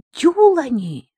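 high, loud cry of horror; shocked cry. Acted. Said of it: "вскрикнула Лайла".

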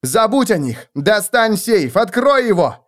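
high, urgent commands; urgent shout. Acted. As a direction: yell over the brawl. Felix yells orders mid-fight.

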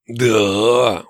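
long, passionate orc sigh; passionate exhale. A narrator's reading. Orc pawing his captive, passionate exhale. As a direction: breathe it out with lust. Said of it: "выдохнул страстно этот урод".

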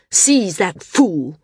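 slow barked order; angry command. A narrator's reading. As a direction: order the guards in fury. Landsman orders guards.

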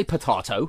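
potato as "po-TAH-to"; long AH in the middle of potato; rejected pronunciation. Shown as a demonstration. Pointing out what nobody says, potato said "po-TAH-to". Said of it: "we don't say potato".

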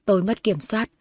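plain reading voice

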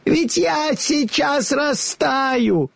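high, slow, terrified witch wail; panicked witch. A narrator's reading. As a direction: wail as she melts away.

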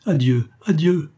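hammed-up anguished farewell; crying out. A narrator's reading. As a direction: call out a dramatic goodbye.

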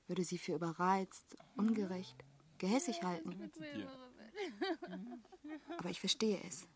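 hushed confession. Acted. Confiding bitterly in low voice.

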